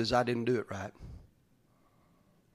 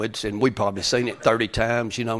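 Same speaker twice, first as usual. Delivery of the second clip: ordinary talk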